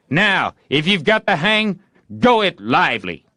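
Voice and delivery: high, loud eager urging; urging boy. A narrator's reading. Tom urging Joe mid-fight, high and eager. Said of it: "Presently Tom said".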